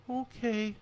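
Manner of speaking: put-on high, soft child's okay; a subdued boy agreeing; small child. A narrator's reading. Calmed boy agrees to his father, small and high.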